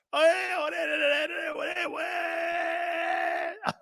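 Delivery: high, drawn-out wordless wail; mock chanting wail. Mock demo of forbidden chanting.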